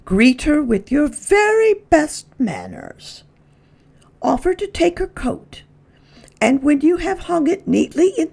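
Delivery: high, prim lady's voice giving orders; a proper lady briefing her pet on manners; Mrs Prim instructing. Acted. Mrs Prim tells Lyle how to greet her guest, prim and high.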